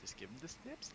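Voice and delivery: high, soft and playful. Pretend. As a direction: coo it in a high voice. Repeated silly phrase.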